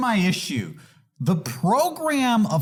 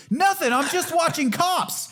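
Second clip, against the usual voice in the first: much higher, playing dumb; mock innocent; 10 semitones higher than usual. Caught by the cops at home, mock innocent and higher. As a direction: sound guiltless and a bit squeaky.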